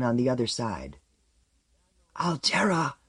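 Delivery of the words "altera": urgent hoarse whispered call. A narrator's reading. Someone calling after him from the barricade, hoarse whisper. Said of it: "in a hoarse whisper".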